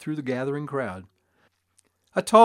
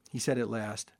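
ordinary delivery, nothing marked

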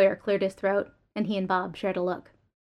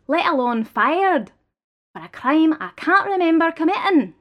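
raised, pleading defendant voice; indignant employee; 5 semitones higher, 6 dB louder than usual. Acted. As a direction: argue back with rising disbelief. Accused employee argues his case.

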